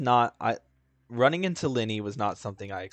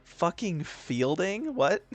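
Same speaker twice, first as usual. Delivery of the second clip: incredulous laugh; 9 semitones higher, slower than usual. Real, not acted. Laughing at the name.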